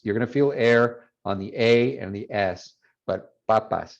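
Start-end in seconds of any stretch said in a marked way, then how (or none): 3.5-4.0 papas with unaspirated Ps; Spanish P. Shown as a demonstration. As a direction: say "papas" with no air on the ps. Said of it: "you shouldn't feel any air".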